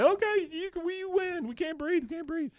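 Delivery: very high, squeaky choking voice; mock-strangled falsetto surrender; strained yelp. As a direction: squeal it as if unable to breathe. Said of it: "they both yell".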